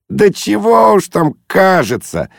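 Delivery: high, drawn-out jolly dismissal; breezy, cheerful. A narrator's reading. Guest waving away the father's objection, cheerfully. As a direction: brush it off merrily. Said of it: "весело махнул рукой Лухин".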